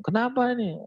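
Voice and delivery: high worried mother asking; a mother rushing in, pitched high; mother voiced high, asking what happened; mother's voice. Mother asks in acted story.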